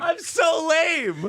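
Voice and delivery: very high, drawn-out shout; mock wail. Friend owns up to being uncool, high mock wail. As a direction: wail it out in playful self-mockery. Mock cry about loving lame stuff.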